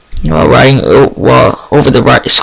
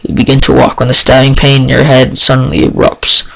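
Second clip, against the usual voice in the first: usual speaking voice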